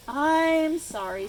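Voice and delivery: mock-teary storybook apology; monkey apologizing through sneezes; sneezed apology. A narrator's reading. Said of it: "sneezed the monkey as tears rolled".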